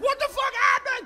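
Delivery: high, loud stunned question; shocked. Retold or imitated. Son walks in on dad on the floor, shocked. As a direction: shout it in disbelief. Replays his shocked shout.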